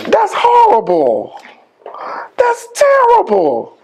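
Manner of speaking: high, slow, wailing mock dismay; mock horror. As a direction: ham up being appalled, almost crying. Mock shock at "you suck.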